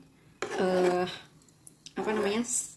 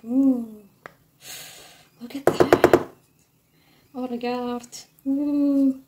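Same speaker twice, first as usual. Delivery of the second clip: awed; 4 semitones higher, much slower than usual. Real, not acted. Admiring finished dish.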